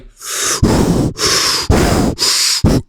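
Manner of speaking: loud, hard huffing breaths; heavy breathing. Retold or imitated. Boy standing over the kid he hit, imitated heavy breathing. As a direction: huff heavily, imitating angry panting. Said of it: "I was breathing heavy".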